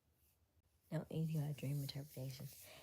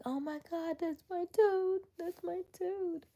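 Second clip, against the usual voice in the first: excited recognition; 13 semitones higher, 3 dB louder than usual. Retold or imitated. Telling how they found their toad, high excited outburst. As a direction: relive spotting it, voice shooting way up.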